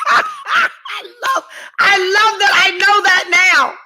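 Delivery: loud, high laughing exclamation; laughing delight. Real, not acted. Woman relishing her new phrase with viewers, loud laughing delight. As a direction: cry it out slowly, tickled by your own joke.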